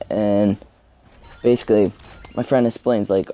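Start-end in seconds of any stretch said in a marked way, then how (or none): none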